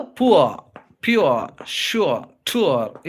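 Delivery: పువా, ప్యూర్, ష్యూర్, టూర్ each drawn out to a final "oo-uh" glide; modelling the diphthong. Shown as a demonstration. Teacher giving example words for ఉవా, modelling the glide. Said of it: "ఈ విధంగా".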